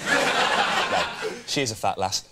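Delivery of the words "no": much higher laughing no; shrieked no. Real, not acted. Co-host at doll, squealing high no.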